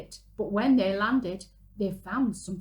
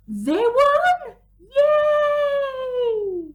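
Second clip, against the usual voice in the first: much higher and louder joyful shout; cheering; 10 dB louder, much slower than usual. A narrator's reading. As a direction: cheer the win for the kids. Snow dog wins race.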